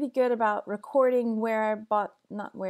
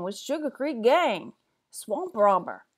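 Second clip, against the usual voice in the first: louder, hammed-up swamp accent on "Swamp Robber"; put-on swamp accent; 5 dB louder, slower than usual. Shown as a demonstration. Redoing the title for viewers in a swamp accent. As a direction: drag out "swamp" in a thick backwoods swamp accent. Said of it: "you can give us a good accent".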